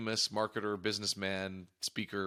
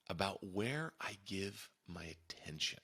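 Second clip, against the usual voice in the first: slow breathy whisper of the key phrase; hushed, deliberate whisper; 7 dB quieter, slower than usual. Shown as a demonstration. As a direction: lean in and whisper it slowly like a secret. Repeats key phrase, first whispered.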